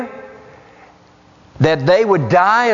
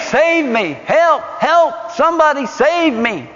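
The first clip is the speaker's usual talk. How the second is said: higher pleading calls, mimicking a drowning swimmer; mock cry for help; 8 semitones higher than usual. Preacher acting the drowning man's cry for help. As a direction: cry out as if going under.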